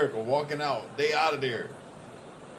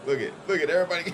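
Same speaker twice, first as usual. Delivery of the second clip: ordinary delivery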